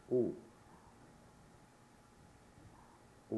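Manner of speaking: "Ooh" as in Sue, lips rounded; rounded-lip vowel. Shown as a demonstration. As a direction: round your lips and sound an isolated "Ooh". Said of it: "have to round your lips to pronounce it".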